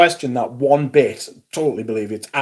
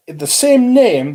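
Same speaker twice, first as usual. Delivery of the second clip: slow clarifying repeat; 7 semitones higher, 5 dB louder, much slower than usual. Shown as a demonstration. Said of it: "I beg your pardon".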